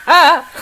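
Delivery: sudden high yelp of pain; a short loud yelp as claws catch; sharp pain cry. Real, not acted. Cat's claws catch her hand.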